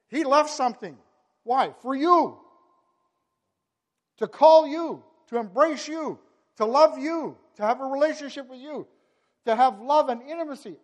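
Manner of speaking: loud, high impassioned preaching; fervent pulpit climax; preaching at full force. Acted. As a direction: pour it out to the congregation.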